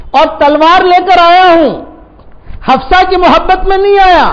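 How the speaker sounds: devoted companion crying out his oath. Retold or imitated. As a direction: call out fervently through the door. Umar calls loudly outside the door.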